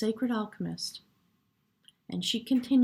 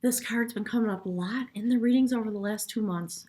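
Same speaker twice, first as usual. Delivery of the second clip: normal talking voice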